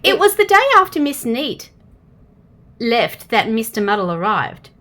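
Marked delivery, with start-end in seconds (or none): none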